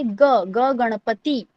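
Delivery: ग drawn out slowly, then Ganpati; slow demo pronunciation. Shown as a demonstration. Teacher demonstrating ग slowly for students. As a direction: say it slowly so kids copy. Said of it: "ग को कैसे pronounce करना है?".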